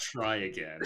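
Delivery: deadpan, a little low; straight-faced. Retold or imitated. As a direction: retell it with an unimpressed straight face. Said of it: "staring at it straight-faced".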